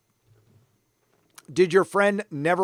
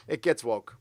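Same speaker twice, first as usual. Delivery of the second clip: quiet echo; 5 semitones lower, 5 dB quieter than usual. Shown as a demonstration.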